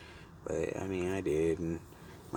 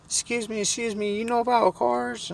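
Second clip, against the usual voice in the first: very high falsetto woman's voice; imitated woman. Stranded lady asks a passerby about cars, in mock falsetto. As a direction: mimic a lady piping up for attention. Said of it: "and she's like".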